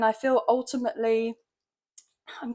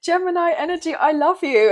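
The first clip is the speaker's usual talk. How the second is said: excited; 6 semitones higher, 9 dB louder than usual. Real, not acted. Excited relay of card message.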